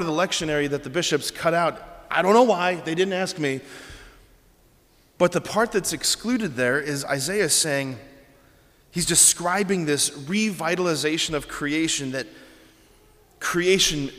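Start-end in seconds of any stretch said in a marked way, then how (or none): none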